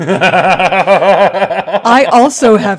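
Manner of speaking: slow words through laughter; laughing. Real, not acted. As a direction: admit it while cracking up. Laughing confession.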